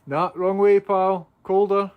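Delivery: high, loud urging call; coaxing a wild bird. Real, not acted. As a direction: urge the bird out, calling up.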